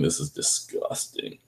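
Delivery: low muttered disgust under the breath; a soft muttered this is disgusting. Retold or imitated. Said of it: "looking at it, going".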